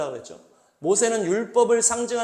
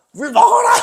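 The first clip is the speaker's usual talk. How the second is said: much higher, much louder yell; furious shout; 13 dB louder than usual. Retold or imitated. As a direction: bark it while striking the rock. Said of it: "열받아서 씩씩거리면서".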